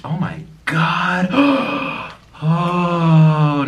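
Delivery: slow, drawn-out amazement; awed. Real, not acted. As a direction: marvel at it slowly. Awed by the food.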